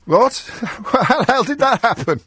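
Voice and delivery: high-pitched, incredulous and laughing; amused disbelief. Real, not acted. Host hearing caller is in the wrong city, amused disbelief. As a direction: react in baffled amusement.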